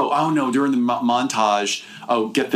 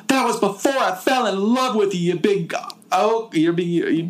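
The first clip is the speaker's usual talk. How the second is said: high, bad Southern belle voice; mock Southern heroine; 10 semitones higher than usual. To her kidnapper, a high hammed-up Southern accent. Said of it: "She's like".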